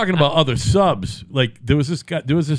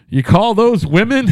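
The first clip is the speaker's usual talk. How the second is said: pitched up, louder cheeky comeback; mock retort; 8 semitones higher, 4 dB louder than usual. Coworker mocking the floor manager, high and loud. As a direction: throw it back as a cheeky jab, high and loud. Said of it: "And one of us said".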